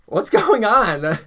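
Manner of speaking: loud, high, drawn-out mock questioning; imitated cop. Cop to costumed guys he pulled over, drawn-out imitation. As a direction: stretch it out like a cop at a traffic stop.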